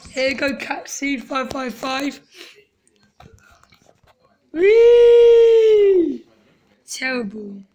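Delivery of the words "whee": excited whee. Real, not acted. Sliding down water slide.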